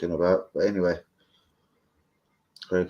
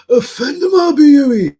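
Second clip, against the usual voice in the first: loud high call across the room; 12 dB louder, slower than usual. Real, not acted. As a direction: shout to catch his mate's attention. Calling out to arriving friend.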